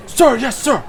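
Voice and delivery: high, loud parade-ground shout; military yes-sir shout; barked soldier reply. Acted. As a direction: snap back like drilled soldiers. Soldiers answer their commander.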